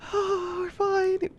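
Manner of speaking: high, slow breathless reply; flustered swoon. Retold or imitated. Women answering the charmer, flustered and smitten.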